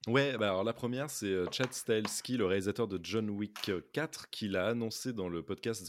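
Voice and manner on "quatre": usual speaking voice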